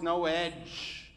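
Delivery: normal talking voice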